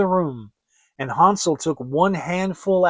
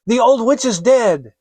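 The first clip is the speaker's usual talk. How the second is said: much higher, louder joyful call; joyful cry; 7 semitones higher, 8 dB louder than usual. Acted. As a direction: shout the good news to her brother. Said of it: "and cried".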